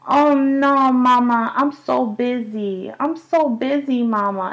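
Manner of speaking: put-on high, slow whiny little-girl voice; imitating toddler. Mother imitating her toddler daughter Zora, high and whiny. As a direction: play the busy daughter brushing mama off. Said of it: "she'll be like".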